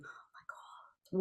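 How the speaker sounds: whispered anxious thought; hushed, drawn-out whisper of worry; whispered dread. Retold or imitated. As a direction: gasp it under the breath, as if thinking it. Retells an anxious thought to self.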